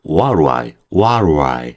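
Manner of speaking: "what I" as WA-ru-ai, stress first; stressed opening. Shown as a demonstration. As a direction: push the start of "what I", reduce the middle. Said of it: "처음에 좀 힘주니까".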